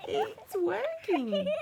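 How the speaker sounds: high, slow, laughing delight; high laughing glee; giggling kid. A narrator's reading. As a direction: giggle through it. Kids delighted plan works.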